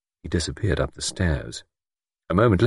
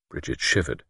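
usual speaking voice

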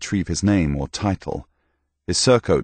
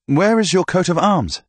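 hearty knight; 12 semitones higher, 4 dB louder than usual. Acted. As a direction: praise him like a proud old soldier. Knight questions Thomas.

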